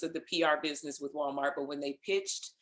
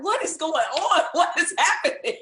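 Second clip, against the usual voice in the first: high, laughing, incredulous "what is going on"; laughing disbelief; 11 semitones higher, 6 dB louder than usual. Retold or imitated. Guest recalls studio onlookers, laughing bewildered disbelief. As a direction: act baffled and crack up mid-question. Retelling surprise at people watching her.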